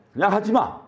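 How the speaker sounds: high, sharp tempter's jab; Satan taunting. Acted. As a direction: push them to quit as the enemy. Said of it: "어, 이렇게". (사단의 날카로운 조롱)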